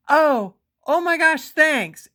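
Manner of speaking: high, loud, drawn-out flustered reaction; acted starstruck shock. Woman retelling a surprise kiss from her idol, starstruck shock. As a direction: blurt it high and loud, stretching the first words.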